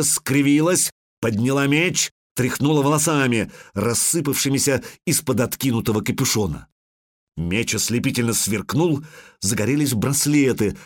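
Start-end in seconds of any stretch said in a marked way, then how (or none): none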